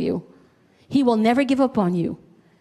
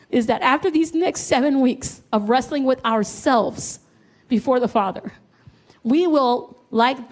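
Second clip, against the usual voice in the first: normal talking voice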